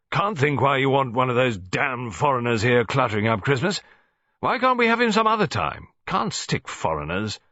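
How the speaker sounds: put-on irritable old soldier grumbling; gruff colonel. A narrator's reading. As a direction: grumble about the foreigner, loud.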